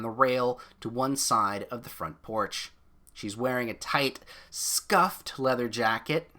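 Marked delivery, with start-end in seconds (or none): none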